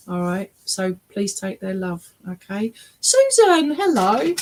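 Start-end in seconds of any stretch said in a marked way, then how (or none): 3.0-4.3 high, loud sing-out of a name; calling out a name. Real, not acted. Medium hails a sitter, calling out. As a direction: call out brightly for attention.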